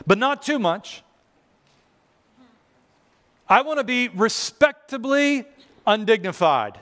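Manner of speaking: high, mincing mock restraint; prim worshiper. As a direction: mimic someone carefully respectable. Mimics the middle class in spirit worshiper.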